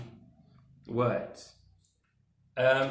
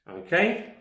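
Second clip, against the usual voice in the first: usual speaking voice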